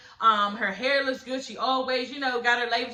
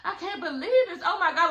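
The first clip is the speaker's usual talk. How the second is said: mock disbelief; 8 semitones higher than usual. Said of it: "she was like".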